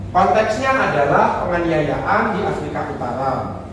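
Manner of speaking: normal talking voice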